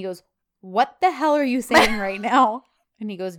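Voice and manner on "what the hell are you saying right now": much louder, slow, angry demand; furious. Retold or imitated. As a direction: confront him at full rage. John grabs Fabry by shirt.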